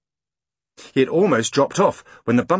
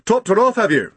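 high, louder policeman voice; jovial constable; london policeman; 10 semitones higher, 5 dB louder than usual. Acted. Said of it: "said the policeman".

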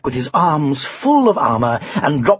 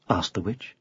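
usual speaking voice

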